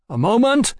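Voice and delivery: high, rising call through a door. A narrator's reading. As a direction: call out to whoever is knocking. Calls out to someone knocking.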